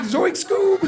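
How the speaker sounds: high cartoon stoner yelp; Shaggy calling his dog, high and laughing. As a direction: squeak it like Shaggy. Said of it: "gonna sound like Scooby-Doo".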